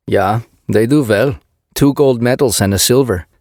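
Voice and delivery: put-on thick Swedish accent, proud; heavy Swedish accent, blunt and proud. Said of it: "his Swedish accent thick".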